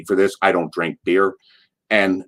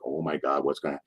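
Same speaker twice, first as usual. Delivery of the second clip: barely audible worried murmur; whisper-soft panic as the tires squeal; hushed dread; 6 semitones lower, 13 dB quieter than usual. Retold or imitated. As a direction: passengers dreading the legend's reaction to the driving. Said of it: "again, a hush in the car".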